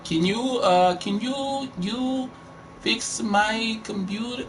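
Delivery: high, slow, halting broken-English imitation; broken-English accent. Caller asking for computer help, mock broken-English accent. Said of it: "bad broken English".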